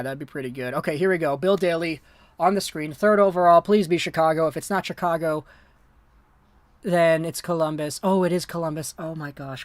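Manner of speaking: normal talking voice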